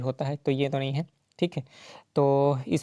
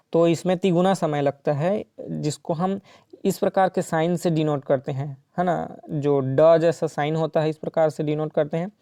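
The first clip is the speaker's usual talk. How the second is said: usual speaking voice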